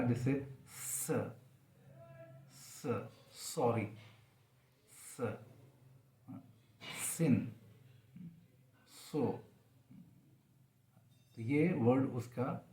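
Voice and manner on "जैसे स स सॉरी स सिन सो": स drawn out, soft and slow at the tooth ridge; quiet slow hiss on स, सिन, सो. Shown as a demonstration. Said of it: "तभी ये सही sound निकलेगा".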